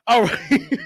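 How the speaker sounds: words squeezed out through laughter; cracking up. Real, not acted. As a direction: try to move on while laughing hard. Cracks up at own joke.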